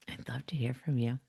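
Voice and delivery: soft unvoiced whisper. Real, not acted. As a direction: whisper it to the listener. Sign-off aside to listeners.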